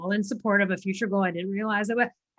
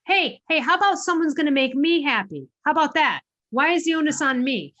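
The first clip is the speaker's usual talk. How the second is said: higher, louder mock challenge; indignant protest; 6 semitones higher, 5 dB louder than usual. Answering the TV movie's line, indignant and loud. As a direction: demand it back, half playful.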